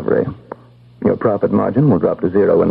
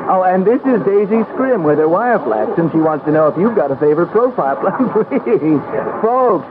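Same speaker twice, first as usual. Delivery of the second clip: higher, bright-eyed bookkeeper playing the fawning clerk; a fussy assistant ushering people to the great man; eager clerk voice; 8 semitones higher than usual. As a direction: chirp out the introductions like a busy little clerk. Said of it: "I'm just the bookkeeper introducing your people".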